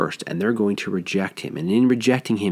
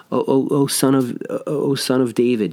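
desperate crying out; 5 semitones higher than usual. Retold or imitated. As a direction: cry out to Jesus, desperate. Canaanite woman crying out.

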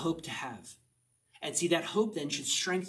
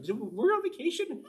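incredulous, laughing; 12 semitones higher than usual. Retold or imitated. Imitated disbelief, laughing.

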